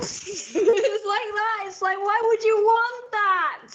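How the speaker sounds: high, slow, laughing disbelief; giggling exasperation. Real, not acted. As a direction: laugh through the question. Laughing at the dog scene.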